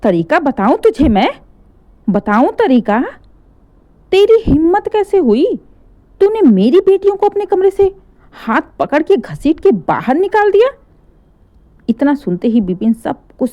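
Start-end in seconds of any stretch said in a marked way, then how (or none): 0.0-10.7 angry. Acted. Mother scolding son.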